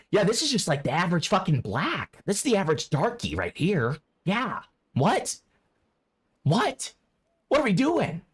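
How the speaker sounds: mimicking the woman from the clip, half-mocking. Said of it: "parodying what I think she would say".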